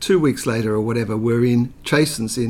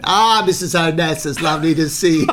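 smarmy delighted greeting; 6 semitones higher, 6 dB louder than usual. Retold or imitated. John greeting Jackie, retold.

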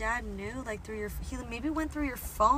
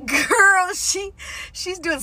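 excited; 8 semitones higher, 8 dB louder, slower than usual. Real, not acted. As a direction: shout it with delight. Excited agreement about the lady.

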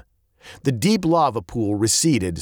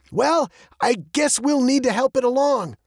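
high, animated dad voice; undaunted dad. A narrator's reading. Dad decides to ram the roadblock, high and undaunted. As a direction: say it undaunted, pitched well up.

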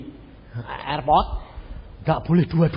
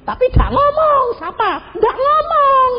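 much higher, loud exclaiming; excited protest; 9 dB louder than usual. Real, not acted. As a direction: cry it out in disbelief. Protes heboh kepada jemaat. (protes heboh, tinggi dan keras)

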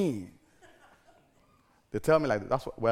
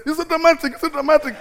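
high, loud teasing singsong; mock the family's complaint, pitched up; 12 dB louder, faster than usual. Family at home teasing the preacher, high and loud mockery. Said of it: "every little night they say".

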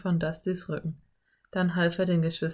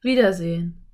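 louder echo, not pitched up; canyon echo; 5 dB louder than usual. A narrator's reading. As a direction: answer back as the canyon's echo. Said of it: "ertönte plötzlich eine Stimme".

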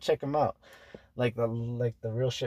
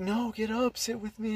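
put-on girl voice; 11 semitones higher, 3 dB quieter than usual. Girl's voice in his story.

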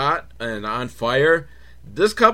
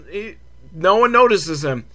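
ordinary talk

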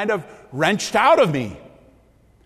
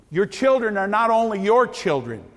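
normal talking voice

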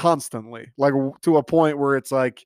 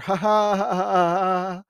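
sarcastic fake laugh; 4 semitones higher, slower than usual. Mock laugh at joke.

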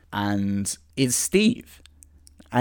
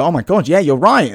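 louder, higher excited agreement; 6 semitones higher, 8 dB louder than usual. Acted. The friends agreeing they are the best team, excited cheer. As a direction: cheer it as a sudden realisation. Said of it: "They all go".

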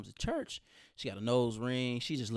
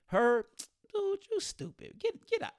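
higher mock-incredulous buddy voice; friend's disbelief; 10 semitones higher than usual. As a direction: scoff like a stunned friend. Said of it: "He said".

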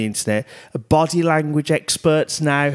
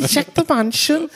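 high mock shout. Repeating comment, high.

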